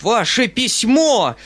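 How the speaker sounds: high, slow, drawn-out shriek; indignant shriek. A narrator's reading. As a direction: explode in a high-pitched squeal. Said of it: "взвизгнул Фома".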